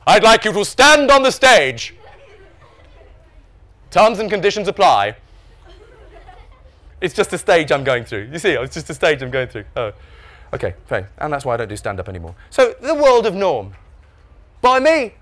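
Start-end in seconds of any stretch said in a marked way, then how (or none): 0.1-5.2 big, slow, loud voiceover; voiceover announcer. Acted. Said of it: "Obviously a future in voiceovers". 12.5-15.2 high, loud, slow title reading; grand title announcement. Acted. Re-announces his book title.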